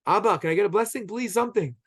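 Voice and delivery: acted-out cry for a blessing; imitated crying out. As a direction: plead like a son begging his father. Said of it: "And Esav cries out".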